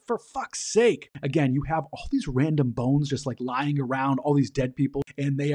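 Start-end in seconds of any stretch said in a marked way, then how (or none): 0.1-1.1 high, drawn-out fed-up groan; exasperated curse. Retold or imitated. Laszlo tossing the wrong skull, exasperated high curse. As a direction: throw it away in disgust.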